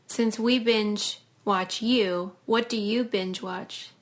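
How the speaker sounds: usual speaking voice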